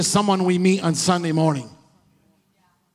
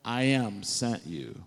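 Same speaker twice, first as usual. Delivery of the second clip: hushed voice of God; 8 semitones lower, 8 dB quieter, slower than usual. Retold or imitated. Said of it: "He said".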